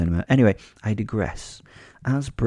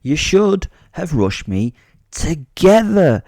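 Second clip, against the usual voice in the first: grand theatrical lecture, pitched up and emphatic; monologuing; 3 semitones higher, 4 dB louder than usual. Acted. Fighter giving beaten attackers sound advice, monologuing. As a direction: lecture them like a self-styled hero. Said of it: "I monologued at them now".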